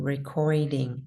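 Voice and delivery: "recording" said slowly, stress on "cor"; slow model. Shown as a demonstration. Teacher demonstrates "recording" slowly for students. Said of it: "It would be recording".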